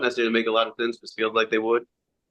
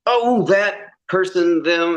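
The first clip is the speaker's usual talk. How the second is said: mock overreaction, higher and louder, drawn out; fake, rehearsed reaction; 9 semitones higher, 5 dB louder, much slower than usual. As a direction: imitate someone straining to hit a scripted line. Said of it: "seems like someone's trying to hit a line".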